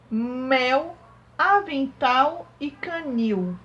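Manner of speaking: word list from "Caracol" to "canil", each final L a drawn-out U; slow, separated words, "futebol" ending in a long OU; endings sounded out. Shown as a demonstration. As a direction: read "Caracol" to "canil" one by one, stretching the endings. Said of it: "observe o som do final das palavras".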